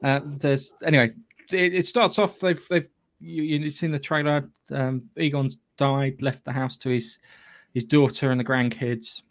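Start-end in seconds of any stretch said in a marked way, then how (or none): none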